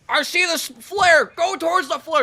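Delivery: high, slow, loud barked order; bossy cop shouting directions; hammed-up high shout; mock yelling cop. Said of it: "like, yelling, he's like".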